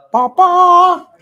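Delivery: loud, high call across the house. Real, not acted. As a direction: shout for him to come. Calling to father across room.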